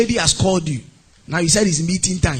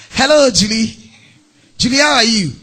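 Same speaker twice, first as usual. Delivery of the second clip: loud, put-on gushing hello; hammed-up proud greeting; show-off greeting; 3 semitones higher, 5 dB louder than usual. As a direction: greet a friend to be noticed, acting. Showing off ring while greeting.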